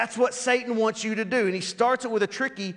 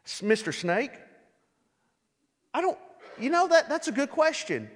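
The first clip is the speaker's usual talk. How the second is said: naive agreeing; 6 semitones higher than usual. Retold or imitated. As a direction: play along sheepishly with the snake. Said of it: "You wouldn't go".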